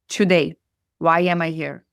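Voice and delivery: normal talking voice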